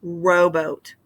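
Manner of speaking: rowboat with ROW stronger; ROW-boat, demonstrating the accent; stress on first syllable. Shown as a demonstration. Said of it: "accented syllable there in the first".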